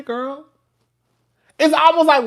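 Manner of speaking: usual speaking voice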